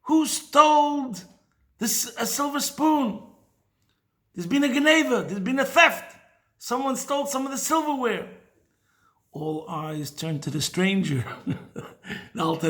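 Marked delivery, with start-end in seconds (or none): 0.0-8.3 a hostess shrieking about a theft; retold screaming. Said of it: "starts screaming".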